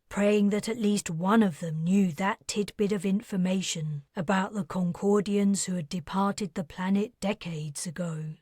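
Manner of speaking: ordinary delivery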